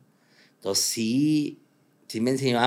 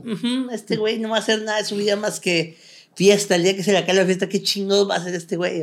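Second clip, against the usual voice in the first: put-on higher, scoffing dismissal of a party boy; mocking doubter; 7 semitones higher, faster than usual. People doubting the party-loving young man, scoffing higher. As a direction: sneer like people who wrote him off. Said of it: "que a lo mejor dices".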